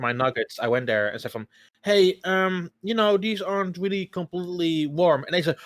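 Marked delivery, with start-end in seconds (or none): 1.8-5.2 higher, hesitant customer voice; retold polite complaint. Customer complaining about cold nuggets, higher polite voice. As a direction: raise the pitch, politely pointing out a problem. Retells own complaint at counter.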